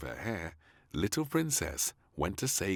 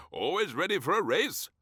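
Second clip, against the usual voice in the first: laughing; 12 semitones higher, 5 dB louder than usual. A narrator's reading. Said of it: "laughed the Prime Minister".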